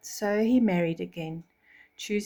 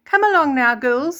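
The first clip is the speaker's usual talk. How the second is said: higher, louder summons; commanding stepmother; 6 semitones higher, 8 dB louder than usual. Acted. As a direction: call the girls off to the ball. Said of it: "said the stepmother".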